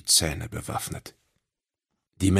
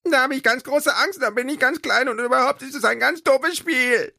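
very high, louder babble with no pauses; chattering nonstop; 5 dB louder than usual. Acted. Frightened little creature babbling to friends, nonstop chatter. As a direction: rattle it off without a breath. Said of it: "schnatterte er ohne Punkt und Komma".

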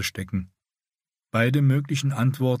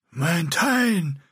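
higher croaked words; croaking; 8 semitones higher than usual. A narrator's reading. Scarred Bretan calling his older partner, higher croak. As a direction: croak it out through scarred throat. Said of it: "krächzte er".

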